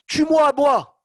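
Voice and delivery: sudden high, loud outcry; desperate cry. Retold or imitated. Moshe cries out to God, ready to die for the people. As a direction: burst out, offering your own life. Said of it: "Il lui a dit".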